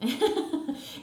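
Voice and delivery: a quick high burst of laughter; short laugh. Real, not acted. Guest laughing at being put in the cap, short high laugh.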